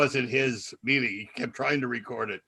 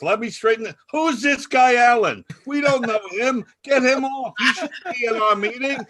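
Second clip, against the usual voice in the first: high, loud mock outrage; hammed-up indignant meeting guest; indignant yelling; 9 semitones higher, 6 dB louder than usual. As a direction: holler as if throwing out an intruder. Retold guest objecting to a stranger.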